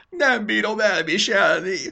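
loud, slow, high-pitched wail; anguished drawn-out outcry; bitter wail. Acted. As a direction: groan it out in theatrical despair. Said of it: "nyögött fel keservesen".

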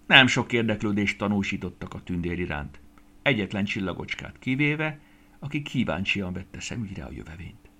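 ordinary delivery, nothing marked

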